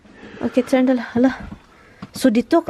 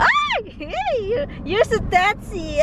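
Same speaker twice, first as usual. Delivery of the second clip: much higher squealing protest; ticklish shriek; 9 semitones higher, 3 dB quieter than usual. Real, not acted. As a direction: yelp as if suddenly tickled.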